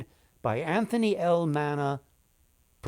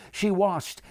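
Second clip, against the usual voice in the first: ordinary delivery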